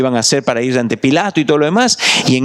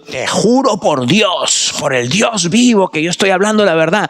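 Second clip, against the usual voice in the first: Peter's oath; 5 semitones higher than usual. Pretend. Peter swearing his oath.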